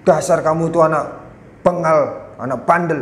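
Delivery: mock scolding. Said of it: "menyerang pribadi anak".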